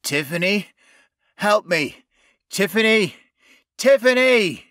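man crying out for rescue; calling for help. A narrator's reading. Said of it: "faint but audible, she heard her name".

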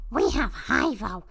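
ordinary talk

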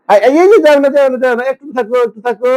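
high-pitched, hurried pleading with a pet; real high, insistent pet-owner pleading; urgent coaxing. Real, not acted. Pleading with cat to stay.

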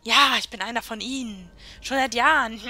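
loud, gloating menace; mock sinister villain. To the frightened woman, a loud mock villain gloat. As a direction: play the bad guy scaring her. Plays villain to scared slave.

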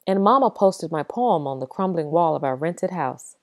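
ordinary delivery

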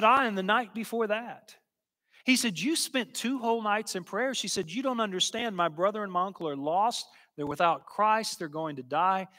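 a lightly voiced, urgent teenage girl insisting; urgent pleading girl. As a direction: plead like a desperate young girl, voice lifted. Desperate girl pleading her case.

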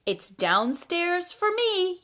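high, slow, drawn-out call; mild storybook yell. A narrator's reading. Fred claims the downstairs room, high drawn-out mock yell. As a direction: call it out high, stretching the words. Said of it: "yelled Fred".

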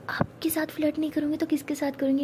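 flirty unvoiced whisper. Pari whispering flirtatiously to Ishani. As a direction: tease her in a whisper.

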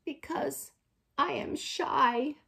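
high, slow, timid little voice; trembling shy voice. A narrator's reading. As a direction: answer shyly, as if trembling. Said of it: "Then a trembling voice says".

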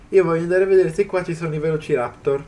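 ordinary talk

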